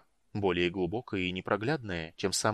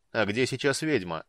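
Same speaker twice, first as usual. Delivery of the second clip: bored; 2 semitones higher, 4 dB louder than usual. Acted. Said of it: "спросил Базак скучающим тоном".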